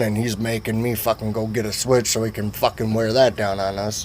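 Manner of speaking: ordinary delivery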